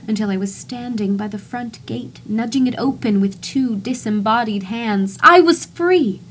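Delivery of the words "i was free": much higher, louder burst of freedom; joyful cry. Acted. Narrator breaks free of the house, joyful shout. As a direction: shout it like escaping a prison.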